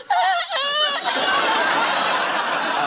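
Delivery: very high mock squealing ah; excited squeal. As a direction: squeal like an overexcited hostess. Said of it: "escalating pitch of excitement".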